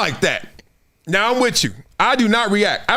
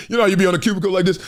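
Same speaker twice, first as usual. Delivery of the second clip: ordinary delivery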